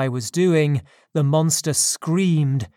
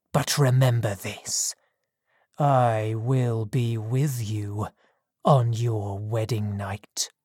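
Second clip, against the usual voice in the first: low, slow, softer threat fading to a whisper; quiet menace; 5 semitones lower, 3 dB quieter than usual. A narrator's reading. Monster threatening the wedding night, low slow whisper.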